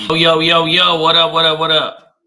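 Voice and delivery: loud, pumped-up greeting to the chat; big loud yo-yo-yo welcome; hype shout. Real, not acted. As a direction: shout it out like a hype man opening the show.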